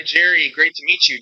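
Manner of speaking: ordinary talk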